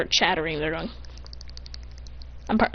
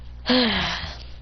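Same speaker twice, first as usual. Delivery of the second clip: long, lower exhale; acted sigh; 4 semitones lower, 4 dB quieter than usual. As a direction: let out a resigned sigh. Character sighs before asking.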